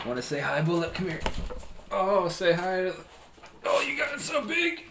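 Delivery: puppy talk, high and cooing. Real, not acted. Talking to his puppy.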